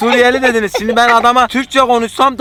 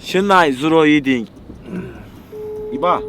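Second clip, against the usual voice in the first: slow fake foreign gibberish; backwards speech; 5 semitones lower, 4 dB quieter, slower than usual. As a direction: drag out reversed words slowly. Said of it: "düz konuşmayacağız".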